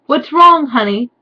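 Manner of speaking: high, loud mock-sweet Draco; hammed-up Draco. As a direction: camp it up as Draco asking his girlfriend. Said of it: "asked Draco".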